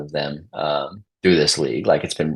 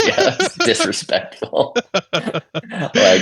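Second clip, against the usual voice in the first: laughing; 13 semitones higher, much slower than usual. Pretend. Laughing tease about bad move.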